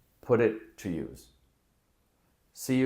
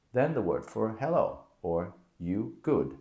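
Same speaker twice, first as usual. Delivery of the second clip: ordinary delivery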